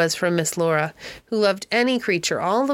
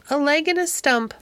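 lines piped much higher, like a parrot; chirpy bird voice; 8 semitones higher than usual. A narrator's reading. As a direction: mimic it like a little bird chirping. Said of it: "Dick imitated him in a few little chirps".

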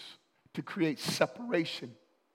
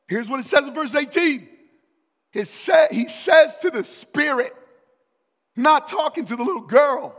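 full-force preaching; 7 semitones higher, 11 dB louder than usual. Acted. Preacher telling the church how Paul got annoyed, loud and high.

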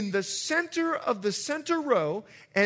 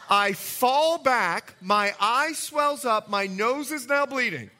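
ordinary talk

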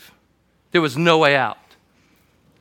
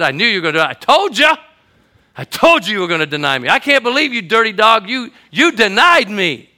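hammed-up scolding, pitch jumping higher; mock scolding Jesus; 8 semitones higher, 10 dB louder than usual. Pastor mocking an imagined Jesus scolding Peter, hammed-up reproach. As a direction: play it as the accuser Jesus never was.

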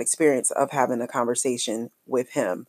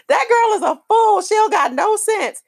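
playful roast; 4 dB louder than usual. Real, not acted.

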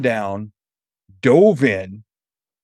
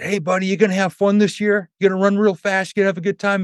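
put-on higher, cheerful, kid-friendly voice; talking to a kid; 8 semitones higher, faster than usual. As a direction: coax a shy child, brightly.

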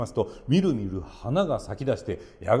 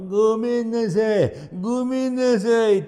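put-on begging old man; 9 semitones higher, 5 dB louder than usual. A narrator's reading. Greedy old man begs while bound.

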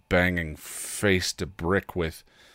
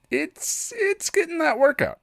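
much higher, playful mock-cheerful; a wry joke in a raised voice; jokey high voice. Real, not acted. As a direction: squeak it out as a self-mocking joke. Joking about immune system workout.

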